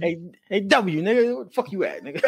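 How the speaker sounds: playful street voice imitation. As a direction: call your boy over, half laughing.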